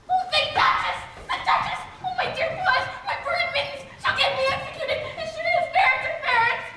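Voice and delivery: high frantic squeal of alarm; panicked rabbit. Acted. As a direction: cry out in a flustered panic.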